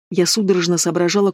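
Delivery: plain reading voice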